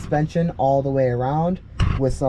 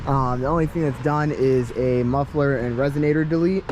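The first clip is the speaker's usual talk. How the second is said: usual speaking voice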